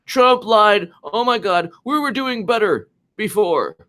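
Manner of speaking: mocking shocked liberal voice; high mocking voice. Mocking media gasping at Trump.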